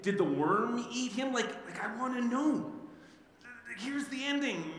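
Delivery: real frustrated, amused outburst at the ending; exasperated excitement. Real, not acted. Preacher exasperated at story's ending.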